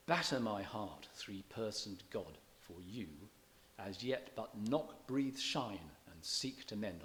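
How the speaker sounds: slow, every stressed beat hammered; slow, deliberate scansion that spoils the sense; over-emphasized metre. Shown as a demonstration. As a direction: thump out each stress like a metronome. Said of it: "ruining the sense of it by emphasizing it like this".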